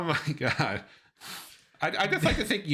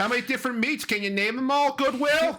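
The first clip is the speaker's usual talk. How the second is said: high Boston heckle; exaggerated Boston accent; 11 semitones higher, 3 dB louder than usual. Family fucking with him, exaggerated Boston accent. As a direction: jeer in a broad Boston accent. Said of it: "really exaggerating the Boston accent".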